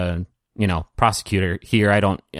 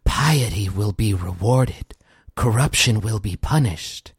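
third disembodied voice. Acted. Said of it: "you hear another voice say".

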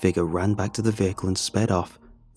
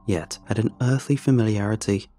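ordinary delivery, nothing marked

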